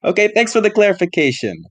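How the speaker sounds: high-pitched, incredulous sarcasm; sarcastic thanks. Acted. Player answering the glamour shot detail, high sarcastic thanks. Player mocks the unwanted explanation.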